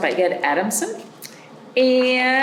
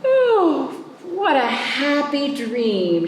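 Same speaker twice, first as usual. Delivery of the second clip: high, slow, blissful sigh; a child waking from a lovely dream; high, slow and tearful-happy; dreamy girl's voice; 7 semitones higher, slower than usual. Acted. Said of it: "she thought".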